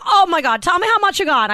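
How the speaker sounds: high-pitched, gushing retold gasp of interest; excited reaction. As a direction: squeal it eagerly, imitating him. Retelling a friend's excited reaction.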